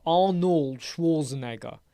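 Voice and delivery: slow "Arnold Schwarzenegger" with a rolled R; rolled R demo. Shown as a demonstration. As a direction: roll the R in "Arnold Schwarzenegger", slowly. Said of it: "So how do you roll the R?".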